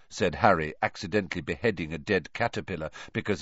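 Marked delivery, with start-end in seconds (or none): none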